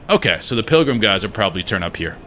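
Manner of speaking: ordinary talk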